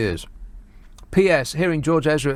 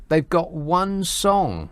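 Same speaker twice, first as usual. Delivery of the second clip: slow scornful emphasis; 3 semitones higher, much slower than usual. Real, not acted. Scoffing at the Spice Girls.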